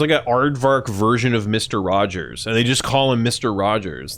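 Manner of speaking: ordinary talk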